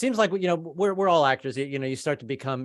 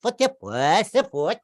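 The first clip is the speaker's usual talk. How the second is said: hammed-up Swedish accent; 2 semitones higher, much slower than usual. Shown as a demonstration. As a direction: babble like a Swede talking backwards. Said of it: "pretend like you're talking backwards".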